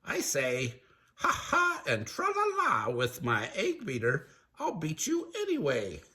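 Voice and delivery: high, theatrical cartoon villain; creepy chef, menacing. Creepy chef confronting the disguised cooks, high villain voice. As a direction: draw it out as a gloating villain.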